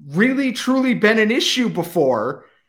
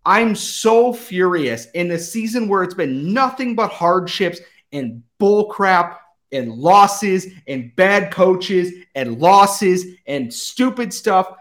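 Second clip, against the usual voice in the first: ordinary talk, nothing marked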